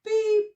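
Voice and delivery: one high, short beep; mimicking the construction-site beep; bird beep. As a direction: chirp a single electronic beep. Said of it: "all he could do is go beep".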